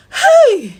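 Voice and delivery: sharp, high shouted call; alarmed shout. Retold or imitated. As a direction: yell out for help. Calling out in alarm.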